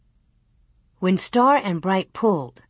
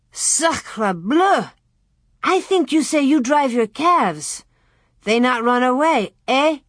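higher, reproachful French-flavored voice; French boy; 5 semitones higher than usual. A narrator's reading. Pierre wipes off snow and reproaches Almanzo, French boy. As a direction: scold a friend as a French boy.

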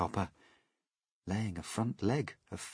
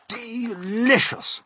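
high, loud, drawn-out relish; savouring delight; 8 dB louder, much slower than usual. Acted. Grasshopper tasting peach, savouring delight. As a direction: stretch the word with delight.

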